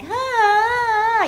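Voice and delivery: long high rising and falling ah; sung child vocalizing. Shown as a demonstration. Therapist imitating a child's melodic vocalizing, high and loud. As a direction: croon a melodic ah like a toddler. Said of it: "rising and falling intonation pattern".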